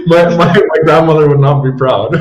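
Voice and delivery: speaking through laughter; laughing. Real, not acted. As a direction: laugh while saying it.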